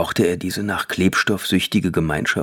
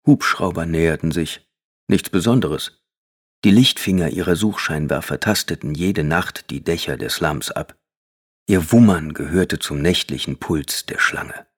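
ordinary delivery, nothing marked

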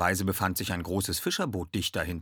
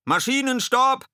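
high, loud command shout; urgent high bellow; bellowed order; 9 dB louder than usual. A narrator's reading. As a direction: roar the order to the engine room. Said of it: "brüllte Ed".

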